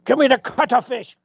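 loud, high cartoon demand; loud character voice. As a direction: bark the order in a cartoon voice.

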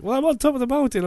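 high, fast matter-of-fact voice; light mock-innocent reply; breezy girl. Imitating the girl answering from the summit, high and quick.

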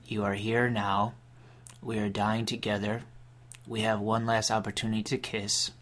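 normal talking voice

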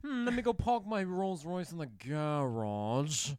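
slow, pompous mock British accent; a posh Brit who moved to Boston. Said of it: "That's not a good British".

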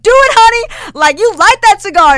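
very high, loud mock holler; rowdy cheering. Storyteller mimics guys at next table, rowdy cheering. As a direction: whoop her on from the next table.

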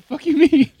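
imitating dog. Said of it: "He's like".

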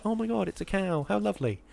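high, cooing mock delight; acted delight. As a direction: play up sweet wonder at a lovely sight. Voicing delight at passing cows.

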